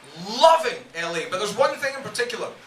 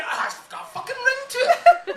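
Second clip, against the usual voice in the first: laughing; 12 semitones higher, 5 dB louder than usual. Real, not acted. Laughing at silly name.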